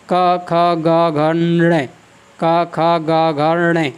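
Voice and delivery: का, खा, गा, घा, ण recited in a measured row; syllable drill. Shown as a demonstration. Teacher reciting the ट-row to show the nasal at its end. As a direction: chant the row slowly, landing on ण. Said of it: "जैसे".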